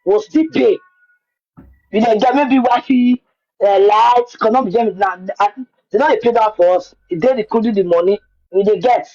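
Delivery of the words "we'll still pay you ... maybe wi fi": ordinary delivery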